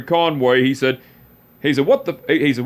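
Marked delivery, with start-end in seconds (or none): 1.9-2.2 uncle's teasing exclamation. Retold or imitated.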